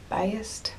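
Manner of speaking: soft, questioning "Biased" as BYE-ust; trying a pronunciation. Shown as a demonstration. Unsure how to say the word, "Biased" as rising BYE-ust. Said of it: "or however it's pronounced".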